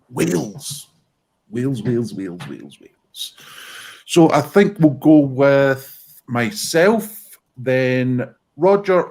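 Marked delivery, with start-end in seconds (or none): 0.1-3.3 fading to a whisper. Acted. Dramatic topic reveal fading away.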